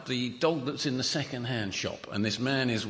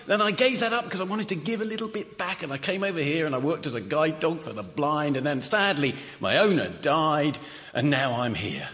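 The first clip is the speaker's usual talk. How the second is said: higher, chirpy dog voice bragging; a lightly voiced cocky dog; boastful talking dog; 9 semitones higher than usual. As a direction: brag like a dog selling himself. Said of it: "the dog says".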